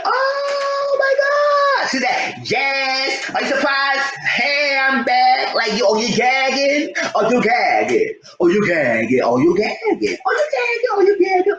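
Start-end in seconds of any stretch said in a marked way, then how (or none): 0.0-1.9 high screaming oh my god; a screaming, crying oh my god; shocked scream. Retold or imitated. Shocked reaction to reveal. 2.5-6.9 a hammed-up high gloating taunt. Catfish gloating reveal. 7.1-10.2 mocking taunt. 10.3-11.5 very high squealing taunt; a shrill, squealed oh you gagging; shrieking taunt. Retold or imitated. Repeated mocking taunt peaks.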